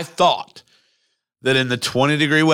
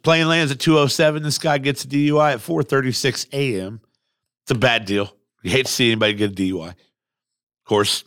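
ordinary talk, nothing marked

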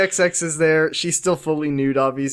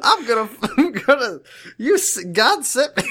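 mock country boy; 8 semitones higher than usual. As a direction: proclaim faith like a yokel.

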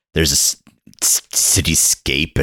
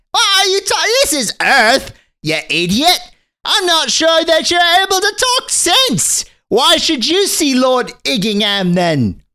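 put-on much higher, prim boy's voice; snooty little boy. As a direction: look down your nose like a posh little boy. Boy scolding the explorers.

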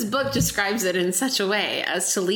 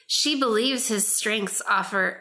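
ordinary delivery

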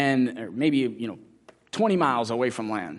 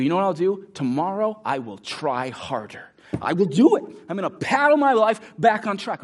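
rising to a louder, higher vow; mock resolve; 5 semitones higher, 4 dB louder than usual. Addict vowing to try harder, hammed resolve. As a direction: promise yourself, straining harder. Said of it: "They go".

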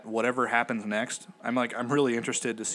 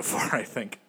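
ordinary talk

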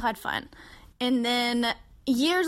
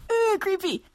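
creeped out; 10 semitones higher, 3 dB louder than usual. Real, not acted. Reacting to her creepy tale.

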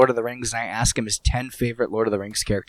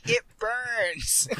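mock kid whine; 8 semitones higher, 8 dB quieter than usual. Kid voiced, eyes stinging.

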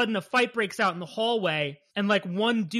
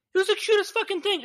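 gushing high voice; 10 semitones higher, faster than usual. Real, not acted. Gushing over duckling.

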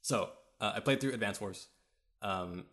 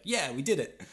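imitated happy winner, pitched up; mock victory cheer; 5 semitones higher, 4 dB louder than usual. Game officer celebrating a won battle, playfully triumphant. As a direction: cheer lightly like a game hero. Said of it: "won out will be like".

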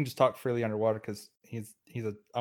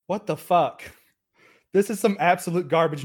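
frustrated outburst; 6 semitones higher, 7 dB louder than usual. Real, not acted. Bad dice roll, frustrated player.

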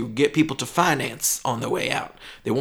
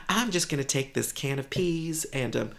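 higher shopper voice; woman shopper; 5 semitones higher than usual. Acted. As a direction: play a dithering shopper.

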